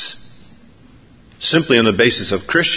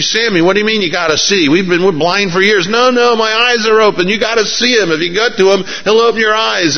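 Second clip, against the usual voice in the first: higher, quick, urgent voices of the blind men and their friend; excited back and forth; 8 semitones higher than usual. Retold or imitated. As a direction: argue it out like old buddies. Acted dialogue blind men and Sammy.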